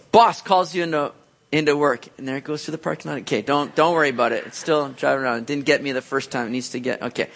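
ordinary delivery

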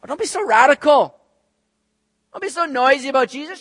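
loud, high-pitched mock reproach; acted disapproving nag; scolding world. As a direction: tell off an overzealous believer. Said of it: "the world to kind of quiet us down".

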